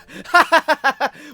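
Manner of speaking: loud high burst of laughter; a real loud laugh at the scene. Real, not acted. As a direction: crack up hard. Laughing at the game.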